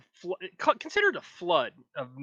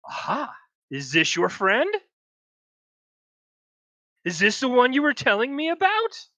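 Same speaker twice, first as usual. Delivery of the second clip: loud, higher taunting spirit; second evil voice; 8 semitones higher, 7 dB louder than usual. Acted. Second spirit mocking Nenny about her friend, loud and taunting. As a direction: sneer it like a mocking demon. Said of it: "a little bit deeper, same kind of voice".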